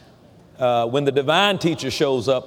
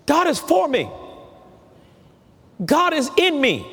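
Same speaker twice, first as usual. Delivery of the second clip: preaching at full force; 6 semitones higher than usual. Acted. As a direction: preach it, building to a climax. Preacher builds to a shout.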